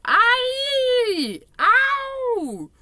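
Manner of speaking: loud high yelps; pained yelps. A narrator's reading. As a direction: cry out in pain, loud and high. Giant thrashing in nightmare.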